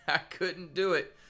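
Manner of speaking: chuckling through the words; laughing. Real, not acted.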